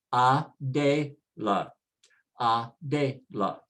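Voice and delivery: Adela slowly in syllables, ah-DEH-lah; sounded out slowly. Shown as a demonstration. Teacher models column one for learners, slow syllables.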